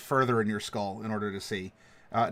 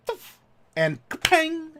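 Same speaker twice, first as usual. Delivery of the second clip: crossbow impact sound effect; 14 semitones higher than usual. Acted. GM voices the crossbow bolt hitting.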